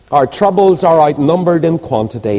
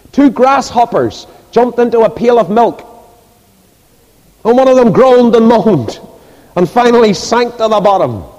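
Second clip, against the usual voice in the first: high, raised preaching voice; 10 semitones higher, 6 dB louder than usual. Real, not acted. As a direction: project it to the whole congregation. Preaches at full force.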